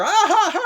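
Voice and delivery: very high, loud mock outburst; hammed-up shrieky "ah, ha, ha"; mock wailing laugh. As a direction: cry out high in comic imitation. Acting out a comic argument.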